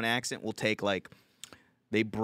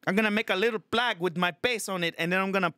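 put-on high-pitched, indignant Filipino accent; livid Filipino tenant; 9 semitones higher than usual. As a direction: protest like a fed-up Filipino tenant. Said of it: "Filipino tenant that's fucking just livid".

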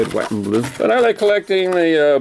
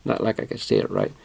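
mumbled aside; 12 semitones lower, 7 dB quieter than usual. Real, not acted. Muttering aside about pronunciation.

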